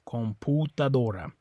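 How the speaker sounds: COMPUTADORA with U as POO; pure oo in COMPUTADORA; Spanish oo sound. Shown as a demonstration. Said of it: "it's always an oo".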